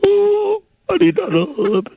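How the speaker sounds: high, whiny fake sob; comic blubbering over needing a lawyer; hammed-up crying. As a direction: wail it like a grown man putting on tears. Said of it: "go ahead and let it out".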